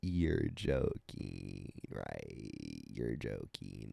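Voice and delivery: low, very slow deadpan; a slow, low unimpressed drawl lying on the floor. Retold or imitated. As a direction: drag it out low and flat, unbelieving. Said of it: "hella laying on the floor like".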